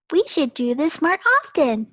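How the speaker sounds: put-on high, loud kid glee; pleased kids. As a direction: say it smugly. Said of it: "they said".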